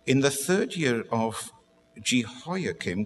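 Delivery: slow Bible reading with pauses; unhurried restart. Real, not acted. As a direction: take the verse again, slowly and measured. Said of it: "I do rush on a little bit".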